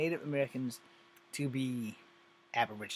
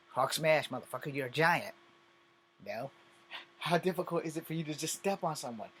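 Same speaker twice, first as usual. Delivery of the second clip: normal talking voice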